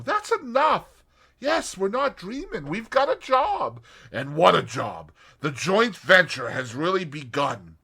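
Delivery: high and loud with glee; bubbling over at the new job; excited young woman. A narrator's reading. Tuppence voiced, thrilled.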